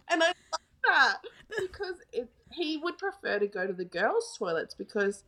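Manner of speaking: ordinary delivery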